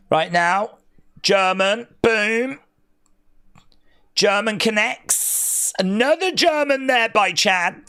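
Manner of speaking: punchy play-by-play call; wrestling commentator. Acted. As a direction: hit each move as it lands. Calls the match play-by-play.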